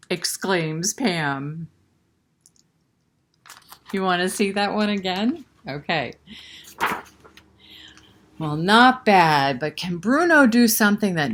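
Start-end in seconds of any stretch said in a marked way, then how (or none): none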